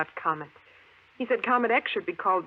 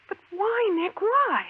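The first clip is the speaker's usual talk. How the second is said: frightened plea; 10 semitones higher, 4 dB louder, slower than usual. Acted. Trapped underground, demanding an answer.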